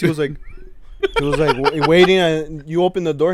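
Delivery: normal talking voice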